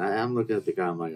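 ordinary delivery

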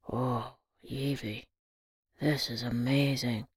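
soft, low and slow; moved. A narrator's reading. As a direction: let it catch with emotion. Granddad moved by painting.

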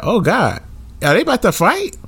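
high, alarmed questions; worried a fight starts. Retold or imitated. Retold alarmed thought.

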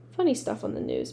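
usual speaking voice

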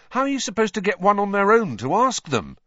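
high, loud complaint; lightly voiced boy, pitch and volume up; exasperated boy. A narrator's reading. Harry asking Ron about approaching girls, exasperated.

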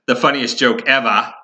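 playful Boston-area Massachusetts R-dropping on "ever"; joking Massachusetts accent. Shown as a demonstration. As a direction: drop the R in "ever", Massachusetts style. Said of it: "the Massachusetts accent".

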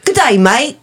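high, hammed-up British accent, sounding Australian; wobbly mock British-Australian accent; bad British accent. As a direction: attempt British and land near Australian. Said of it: "So that was a British accent?".

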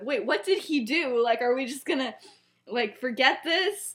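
a mock incredulous Sirius; confused protest. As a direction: demand an answer, baffled.

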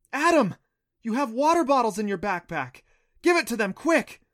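high, loud, urgent call; urgent insisting. A narrator's reading. Clancy urging Adam to give the naiads water, loud and urgent. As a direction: yell it at him to hurry. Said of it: "Clancy insisted".

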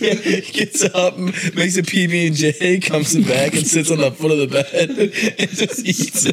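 laughing. Real, not acted. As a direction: crack up while telling the absurd story. Cracking up at absurd scenario.

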